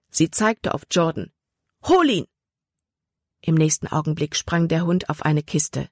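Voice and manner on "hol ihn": much higher, loud order; urgent command. A narrator's reading. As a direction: send the dog after the boy. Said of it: "befahl Libby". (lauter, hoher Befehl)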